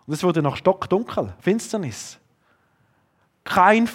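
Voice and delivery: ordinary talk, nothing marked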